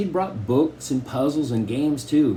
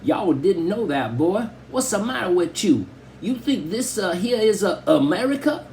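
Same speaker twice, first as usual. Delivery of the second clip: put-on Southern hillbilly accent; 7 semitones higher than usual. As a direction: keep up the mock hillbilly bit. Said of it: "say some more Southern style, style stuff".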